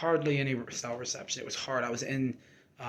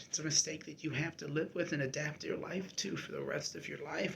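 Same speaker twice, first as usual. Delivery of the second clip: ordinary delivery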